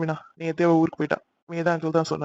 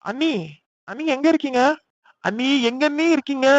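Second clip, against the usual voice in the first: higher, searching call across the house; 6 semitones higher than usual. Acted. Brother-in-law searching the house for sister-in-law, calling out. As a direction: call out for her room to room.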